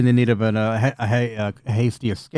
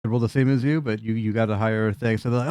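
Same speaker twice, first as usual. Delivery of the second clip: ordinary delivery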